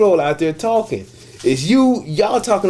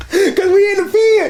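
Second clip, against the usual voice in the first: high, laughing and louder; laughing through words; 13 semitones higher, 3 dB louder than usual. Real, not acted. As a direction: squeeze the words out between laughs. Laughing while retelling fight.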